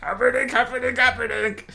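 put-on high, drawn-out old crone yell; Princess Bride old witch. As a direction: shriek the name like a cackling hag. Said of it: "the old couple in, uh, Princess Bride".

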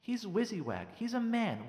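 ordinary delivery

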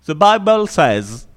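put-on slow, English-accented "The Bible says"; mock English preacher accent. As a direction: say it like an anglophone preacher. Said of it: "ça se dit comme ça".

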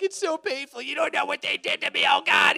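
high wailing complaint, hammed up; whiny hurt churchgoer. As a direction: whine it like a wounded martyr. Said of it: "we'd all be like".

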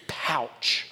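"Pouch" softly puffed out on the breath; savoring the word. Shown as a demonstration. Preacher enjoying the word "Pouch", saying it softly for fun. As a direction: relish "Pouch" as a lone, hushed word. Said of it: "It's just fun to say".

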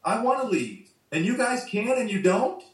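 high, desperate rant; losing his mind. Retold or imitated. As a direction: plead then snap at the complainers, acted. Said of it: "losing his mind for real".

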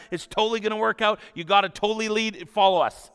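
hammed-up committee enthusiasm, pressing him; loud, high, excited pleading; pushy eager disciples. Disciples pushing Jesus onto boat.

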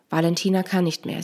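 ordinary delivery

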